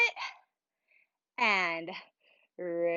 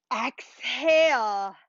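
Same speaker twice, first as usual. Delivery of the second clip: drawn-out exhale; 2 semitones higher, 5 dB louder, much slower than usual. Shown as a demonstration. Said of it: "breathing cue demonstrated".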